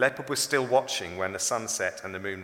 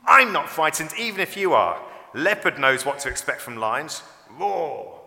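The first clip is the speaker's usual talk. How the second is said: loud, raised, cocky voice; defiant boast; 4 semitones higher, 7 dB louder than usual. A narrator's reading. Toto refusing to hide from Leopard, defiant. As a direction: brag like you fear nothing.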